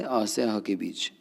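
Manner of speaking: ordinary talk, nothing marked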